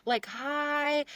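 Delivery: high, small-voiced reply; overwhelmed polite greeting. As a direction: squeak out an awkward hello. Overwhelmed reply to gushing fan.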